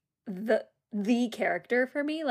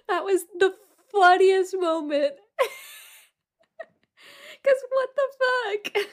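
high, loud words breaking into tearful laughter; can barely get it out for laughing; laughing till crying; 9 semitones higher, 3 dB louder than usual. Real, not acted. Recapping Tom's blunder to her co-host, laughing till she cries.

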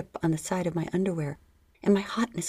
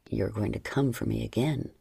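low, firm male lover voice; John's low voice; 9 semitones lower than usual. Acted. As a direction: drop low and sure for the man. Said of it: "he said, leaning back from my lips".

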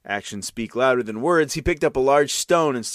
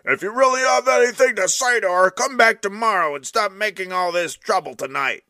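scolding neighbor; 8 semitones higher, 3 dB louder than usual. Acted.

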